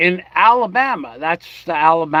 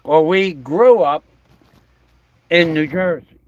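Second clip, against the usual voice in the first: ordinary talk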